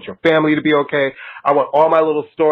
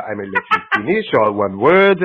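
ordinary delivery

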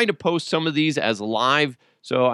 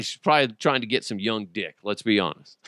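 normal talking voice

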